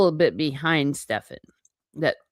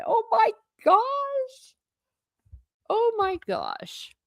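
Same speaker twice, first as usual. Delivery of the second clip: high, slow delighted squeal; gushing fan; slower than usual. Real, not acted. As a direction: swoon over the moment. Fan gushing over the scene.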